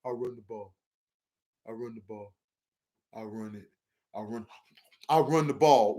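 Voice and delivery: very low, soft, dopey voice; mock Andy Reid. Streamer mocking Andy Reid's stubborn play calling, very low and dopey. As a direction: mumble it deep down like a lazy oaf. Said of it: "he'll be like".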